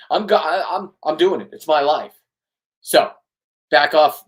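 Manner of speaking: angry outburst. Real, not acted. Telling the absent ex to back off, furious shout. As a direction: blow up and push them away. Confronting an ex, rising rant.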